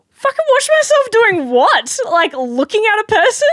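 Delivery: high, loud disbelief; indignant outburst. Retold or imitated. Worker reacting to a customer's warning, loud and indignant. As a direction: retort in outraged incredulity, voice shooting up. Retelling her outrage at rude customer.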